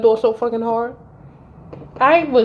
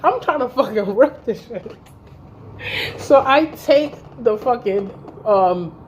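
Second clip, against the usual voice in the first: usual speaking voice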